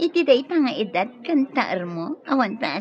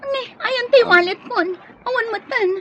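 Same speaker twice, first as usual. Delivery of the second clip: high whimpering complaint; higher, crying voice about her lost wallet; distressed wail; 13 semitones higher, faster than usual. Acted. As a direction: near tears over the lost wallet.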